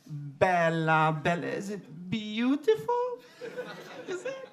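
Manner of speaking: slow, lilting Italian charmer; Italian accent. Retold or imitated. Italian guy charming the woman, slow lilting accent. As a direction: draw out the Bella like a crooner. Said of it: "he's going".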